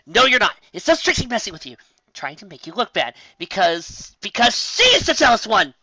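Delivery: high, loud self-reassurance; pumped-up loud denial; heated insistence. Acted. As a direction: argue yourself back up.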